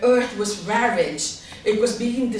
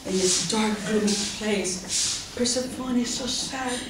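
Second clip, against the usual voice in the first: usual speaking voice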